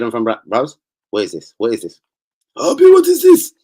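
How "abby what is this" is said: very high, loud mock outrage; hammed-up, high-pitched disbelief; outraged put-on character. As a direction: shriek the question in a put-on voice. Same question in put-on outraged voice.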